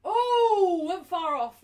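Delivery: big loud oh at a near miss; loud groan. Real, not acted. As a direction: react loudly as the shot flies wide.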